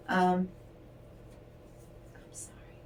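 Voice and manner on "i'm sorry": breathy, unvoiced apology; quick hushed sorry to someone in the room; whispered aside. Real, not acted. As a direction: murmur it off mic under your breath. Aside off the reading.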